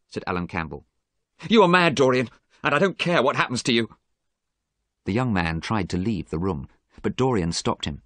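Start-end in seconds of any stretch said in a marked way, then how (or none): 1.5-3.9 angry outburst. A narrator's reading. Alan angrily refuses.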